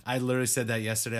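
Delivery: ordinary delivery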